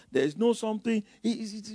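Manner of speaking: ordinary talk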